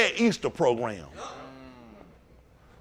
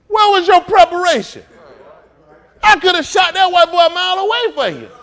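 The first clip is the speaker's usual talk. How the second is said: much higher and louder, a taunting call; mocking shout; 11 semitones higher, 8 dB louder than usual. Taunting the guards who let him walk in, mocking shout. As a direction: jeer at them from a distance.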